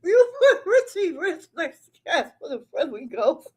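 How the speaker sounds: high, giggly squeeze of words; crying with laughter. Real, not acted. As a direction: talk through helpless laughter. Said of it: "y'all got me crying right now".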